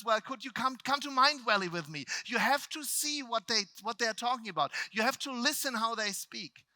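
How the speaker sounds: high, loud, insistent invitation; eager urging. Retold or imitated. Speaker to friends about coming to Mind Valley, eager urging. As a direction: plead with enthusiasm to come along.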